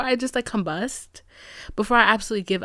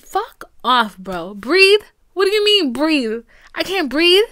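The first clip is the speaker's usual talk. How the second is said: loud, higher-pitched frustrated outcry; exasperated outburst; 5 semitones higher, 7 dB louder, slower than usual. Real, not acted. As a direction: throw the word back in disbelief. Angry at being told breathe.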